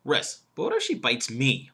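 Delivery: slow build, "me" leaned on at the end; stress on me. Shown as a demonstration. Coach shows an awkward third version, stress on "me". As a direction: break after "yes", then land hard on "me". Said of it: "the third one is me".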